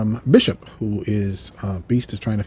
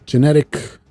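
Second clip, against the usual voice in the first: usual speaking voice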